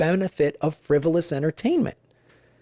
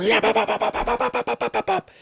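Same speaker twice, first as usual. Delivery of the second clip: rising staccato bop bop bop, pitched up; gunfire noises; 7 semitones higher than usual. Retold or imitated. Gunner on the helicopter mimicking the door gun, rising bops. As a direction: rattle off mock machine-gun fire.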